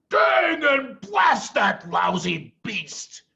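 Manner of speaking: slow, loud, high-pitched angry cry; furious shout. Acted. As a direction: roar it out in farmer's fury. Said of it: "cried Boggis".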